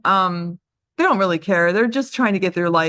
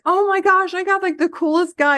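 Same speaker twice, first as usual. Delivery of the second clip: much higher, gushing squeal of surprise; amazed delight; 9 semitones higher than usual. Pretend. Agent thrilled after a great call, excited gush. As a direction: gasp it out like you can't believe your luck. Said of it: "You'll be like".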